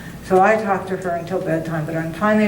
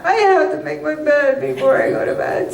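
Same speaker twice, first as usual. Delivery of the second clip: said to the cat; 7 semitones higher than usual. Retold or imitated. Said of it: "said out loud to the cat".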